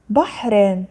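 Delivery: بحر read with an added -in ending; tanween kasr. Shown as a demonstration. Teacher reading out the word list, tanween kasr on بحر. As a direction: sound out بحر as "bahrin". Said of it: "كسر".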